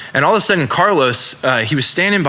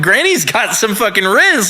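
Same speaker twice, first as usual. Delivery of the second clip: normal talking voice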